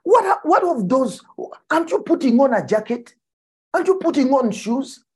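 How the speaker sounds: incredulous preacher. Retold or imitated. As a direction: fire off the questions in mock disbelief. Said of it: "He says".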